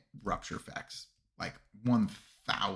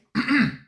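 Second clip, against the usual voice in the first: loud, high throaty gargoyle screech; 12 semitones higher, 9 dB louder than usual. Shown as a demonstration. Streamer does promised gargoyle impression for chat, throaty screech. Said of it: "I'm a gargoyle".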